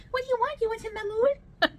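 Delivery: very high, slow squealing cat voice; demon cat voice. Acted. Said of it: "That is Demon Cat Voice".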